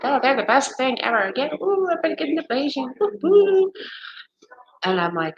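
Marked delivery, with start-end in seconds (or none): 0.0-3.7 high, excited mock cheer rising to a whoop; gushing friend. Friend imitated raving about her ablation, high and excited. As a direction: play the thrilled friend raving. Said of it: "She was like".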